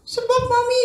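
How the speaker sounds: put-on high, loud little boy; upset child. Boy calling his mother, high distressed. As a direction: call out to mum in distress. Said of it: "katanya gini".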